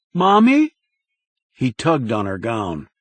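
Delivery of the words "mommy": put-on scared child. A narrator's reading. Boy tugging at mother.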